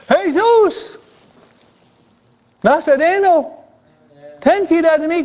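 high-pitched insistent cry for mercy. Retold or imitated. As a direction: belt it out over those hushing you. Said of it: "gritaba más fuerte".

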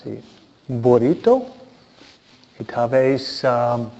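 ordinary talk

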